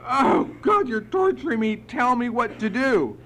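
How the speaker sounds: high, loud, exasperated cry; screamed out. Retold or imitated. Sponsee begging sponsor for direction, screaming in frustration. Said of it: "I screamed out".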